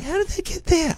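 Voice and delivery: put-on high falsetto girlfriend voice; mock high-pitched woman's question; imitated girlfriend. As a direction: put on a high, helpless squeak. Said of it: "And she's like".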